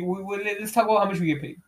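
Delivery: ordinary delivery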